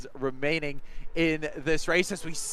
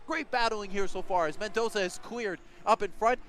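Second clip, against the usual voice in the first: ordinary delivery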